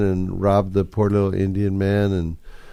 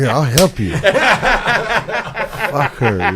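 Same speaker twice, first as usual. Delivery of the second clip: laughing while joking along; playful laughing mock offer; laughing mock agreement; 8 semitones higher, 5 dB louder, much slower than usual. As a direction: say it through a laugh, clearly joking.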